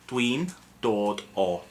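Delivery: Dwi'n dod o stretched out, each syllable slow; teacher drilling Dwi'n dod o slowly; slow Welsh pronunciation. Shown as a demonstration.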